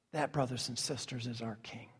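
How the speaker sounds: very soft, low and reverent; solemn hush. Real, not acted. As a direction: let it sink down almost to a whisper. Solemn close of the point.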